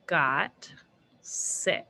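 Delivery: drawn out, one word at a time; slow dictation. Shown as a demonstration. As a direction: spell it out slowly. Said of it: "I'm gonna write three lines".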